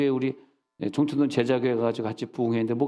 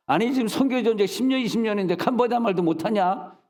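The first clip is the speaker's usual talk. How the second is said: higher, indignant rebuke; exasperated retold tirade; retold scolding; 8 semitones higher than usual. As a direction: scold the missionaries in disbelief. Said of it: "막 야단쳤다니까".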